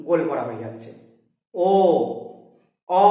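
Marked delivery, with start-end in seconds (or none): 1.5-3.0 ও and অ said with lips rounded; rounded lips vowels. Shown as a demonstration. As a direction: round the mouth on ও, then অ. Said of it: "দেখো মুখটা একটু গোল".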